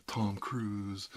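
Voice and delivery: normal talking voice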